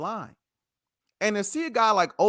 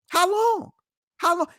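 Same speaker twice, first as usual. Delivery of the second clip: exasperated cry; 10 semitones higher than usual. Real, not acted. Host pleading to the audience, exasperated high cry. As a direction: demand it at the top of your voice. Host demands how long Odell stays quiet.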